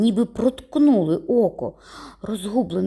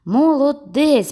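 higher, louder, slow praise; emphatic praise; 7 semitones higher, 5 dB louder, slower than usual. A narrator's reading. Judge repeats his praise to the boy, louder. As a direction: say it again with real weight.

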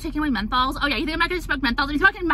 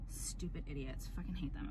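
muttered contempt; 9 semitones lower, 20 dB quieter than usual. Real, not acted. Muttering after parody.

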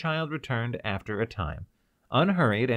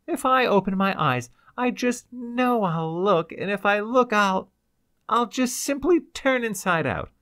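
lightly acted tearful plea, much higher and louder; sobbing; 11 semitones higher, 6 dB louder than usual. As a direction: beg him, near tears. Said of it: "she sobbed".

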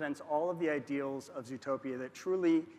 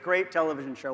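usual speaking voice

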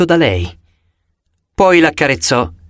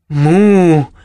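moo. Acted. Cow serpent speaks.

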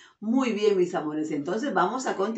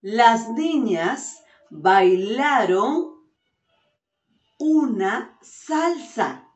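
very slow and louder, with a long pause before "una salsa"; slow clear dictation; 2 semitones higher, 4 dB louder, much slower than usual. Shown as a demonstration. Teacher reading a sentence to pupils on Zoom, slow and loud. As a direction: stretch it out so every child hears. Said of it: "A ver".

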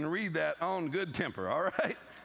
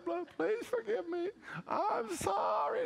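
much higher, very slow whiny begging; mock pleading; slower than usual. Husband begging his wife's forgiveness, high drawn-out whine. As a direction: stretch the sorry out high and pitiful.

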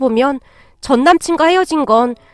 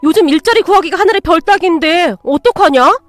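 raised, incredulous rebuke; appalled scolding; 5 semitones higher, 4 dB louder than usual. Acted. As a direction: scold them for a reckless blunder. Woman appalled that ex quit. (어이없어하며 나무라는 말투)

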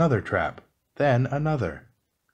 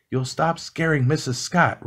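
higher, raised storybook order; mild roar; 6 semitones higher than usual. A narrator's reading. Gus telling Mouse to stop scaring Missus Scott, mild roar. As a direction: scold with a lightly roared command. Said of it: "roared Gus".

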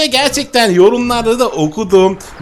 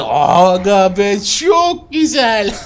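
laughing delight; much slower than usual. Real, not acted. Gamer laughs in delight as his dragon grows, laughing exclamation. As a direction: laugh it out with joy.

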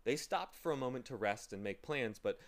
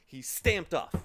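ordinary delivery, nothing marked

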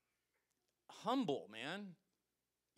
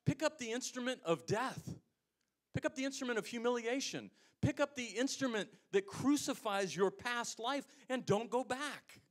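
preaching; 9 semitones higher, 4 dB louder than usual. Acted. Preacher repeats commanding refrain.

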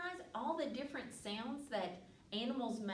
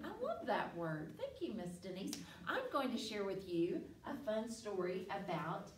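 plain reading voice

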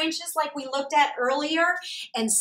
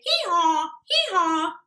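loud, higher hee-haw hee-haw; donkey bray; 6 semitones higher, 5 dB louder than usual. Shown as a demonstration. Teacher modelling the children's loud double bray. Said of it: "I want you to say".

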